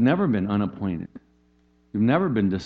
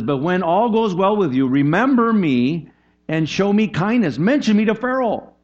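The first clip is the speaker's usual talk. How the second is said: higher, louder, pleading plea for remembrance; pleading Joseph; 9 semitones higher, 6 dB louder than usual. Retold or imitated. Joseph begging the freed butler to remember him, higher and louder. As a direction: beg the butler not to forget you. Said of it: "Joseph says".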